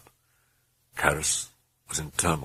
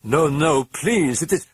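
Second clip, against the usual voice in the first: pleading; 10 semitones higher, 5 dB louder, slower than usual. A narrator's reading. Woman pleading, breaking free.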